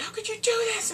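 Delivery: very high, squeaky put-on protest; mock indignation. Characters confronting the kidnapper, high-pitched mock indignation. Character reacts to ransom demand.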